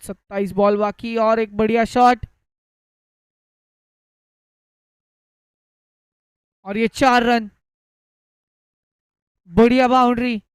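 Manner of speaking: loud rising call of a boundary; excited. Real, not acted. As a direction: shout the four with excitement. Boundary hit, commentator erupts.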